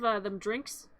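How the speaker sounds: ordinary delivery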